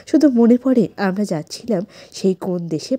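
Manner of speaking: normal talking voice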